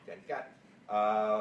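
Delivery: slow drawn out name. Shown as a demonstration. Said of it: "What's your nickname?".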